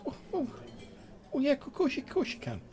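high stammering squeak that drops at the end; Grimwald, squeaky. Acted. Flustered sprite admits the guest can see him, squeaky stammer. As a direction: start high and flustered, then settle. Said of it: "in, like, a really, like, squeaky, high-pitched voice".

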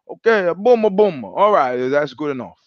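light mock Italian accent; eager recruits agreeing, hammed lightly. Recruits answer sergeant in light mock Italian accent. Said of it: "all the recruits are like".

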